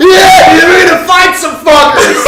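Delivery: high, loud party yell; excited yell. Retold or imitated. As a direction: shout it over a blaring party, all hyped. Joff yelling over a loud party.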